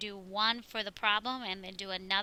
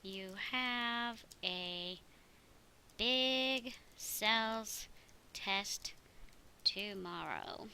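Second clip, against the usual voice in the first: slow dictation; 5 dB quieter, much slower than usual. Shown as a demonstration. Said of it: "And then something like".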